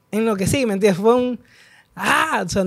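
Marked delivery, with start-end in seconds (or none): none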